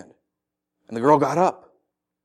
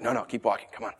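low-key, softer aside; hushed urging; 5 semitones lower, 10 dB quieter, faster than usual. Acted. Walker urging friends to ignore the person shouting, hushed. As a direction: discreetly hurry your friends along.

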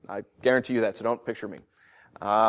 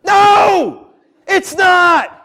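much louder and higher, a sudden preacher's roar; explosive shout; 11 semitones higher, 9 dB louder, much slower than usual. Real, not acted. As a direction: blast the denial out at full force.

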